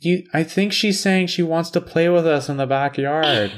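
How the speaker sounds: high, sweet child impression; little kid. Kid wanting the dog to play, high child voice. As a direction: put on a hopeful kid's voice. Kid pleading in retold story.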